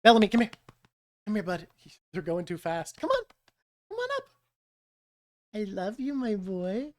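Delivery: hushed, tender coaxing of a pet; soft voice to dog. Real, not acted. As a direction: gently coax the dog up.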